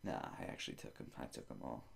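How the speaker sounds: quiet low admission. Acted.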